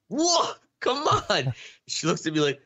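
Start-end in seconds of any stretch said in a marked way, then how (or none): 0.1-1.5 impatient. Retold or imitated. Retold impatience at slow driver.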